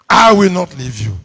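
loud, firm emphasis opening on "I"; emphatic repetition. Real, not acted. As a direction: press "I will not leave you" home, strong on "I". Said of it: "Repetition for emphasis".

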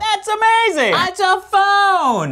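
high, loud excited yell; excited shouting. Real, not acted. Excited yelling reactions.

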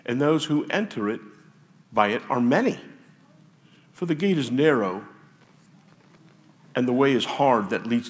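loud, declaiming scripture read. Acted. Pastor reads Scripture to the congregation with force. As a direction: proclaim the verses to the room.

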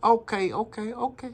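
customer's put-on Chinese accent. Customer phoning restaurant to order, in a Chinese accent. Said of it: "we're gonna do a Chinese accent".

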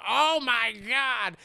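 slow, loud, high horrified cry; shocked gasp. Retold or imitated. Henry horrified by the girl's abandonment story. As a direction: let the shock hit hard. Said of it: "he's like".

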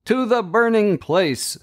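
very high ringing scream of a command; enchanter screaming. A narrator's reading. Enchanter screaming his sentence again, very high. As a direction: shriek the sentence in triumph. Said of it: "screams the enchanter again".

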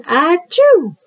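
loud, high playful achoo; mock sneeze. Shown as a demonstration. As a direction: fake a big sneeze for the kids. Demonstrating a sneeze.

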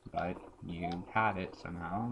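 usual speaking voice

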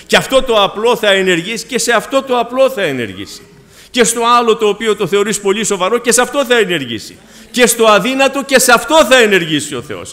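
loud, rising preacher's cry, each phrase pushed harder; preaching at full force. Acted. Preacher proclaiming to the congregation that God acts, full force. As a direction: build it like a pulpit climax.

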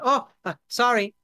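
high, loud flustered apology; startled newcomer bumped in passing; bumbling apology. Acted. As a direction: blurt it high and awkwardly. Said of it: "he kinda looks and".

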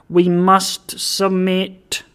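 slow, weighty repeat; solemn resignation. A narrator's reading. Alderman bows to the one above, solemn and slow. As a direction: stretch each word solemnly.

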